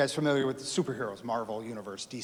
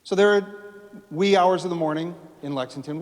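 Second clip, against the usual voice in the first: ordinary talk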